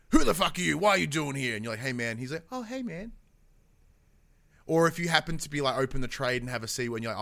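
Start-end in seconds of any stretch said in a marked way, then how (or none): 0.1-1.6 louder gruff shout at the apprentice; mock angry blacksmith. Blacksmith greets rudely. 2.5-3.1 much higher, bright switched-on friendliness; sudden friendly blacksmith. Retold or imitated.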